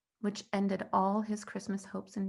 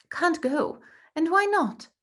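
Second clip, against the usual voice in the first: surprised; 8 semitones higher, 5 dB louder than usual. Acted. Aunt surprised by refusal.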